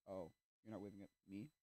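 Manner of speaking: soft, sheepish question; awkward realisation. Acted. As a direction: say it small, tailing up into a question.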